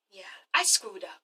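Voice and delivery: hushed, breathy admission; quietly owning the mistake; 1 whispered confession. Retold or imitated. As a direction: whisper it like a guilty secret. Yelena confessing in retold scene.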